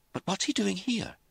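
high-pitched, worried question; anxious. A narrator's reading. Lizzie questions an unexpected visit, anxious. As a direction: ask with mounting unease, voice lifted. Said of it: "Lizzie said anxiously".

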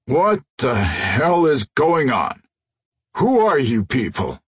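slow, groaning giant; hint of a dazed brute; groggy brute voice. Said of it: "Crusher groaned".

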